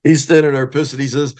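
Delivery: ordinary delivery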